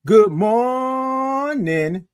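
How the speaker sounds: very slow, high held MORNING; high, sustained sing-out greeting; drawn-out good morning. Shown as a demonstration. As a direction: stretch MOR way out on a high note. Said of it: "That's what I want you to do".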